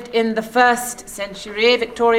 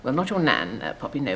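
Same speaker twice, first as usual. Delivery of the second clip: ordinary delivery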